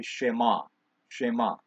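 shema said slowly, sounding out she-ma; careful pronunciation. Shown as a demonstration. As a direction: try the Hebrew word shema carefully. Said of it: "I hope I say this correctly".

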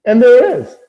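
high, loud burst of excitement; real excited reveal; triumphant find. Real, not acted. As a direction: announce the discovery with a big, high shout. Finds the missing lizard at last.

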